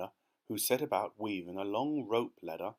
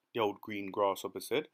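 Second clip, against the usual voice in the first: usual speaking voice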